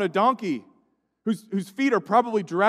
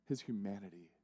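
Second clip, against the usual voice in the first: hushed repeat; 12 semitones lower, 20 dB quieter, faster than usual. Acted.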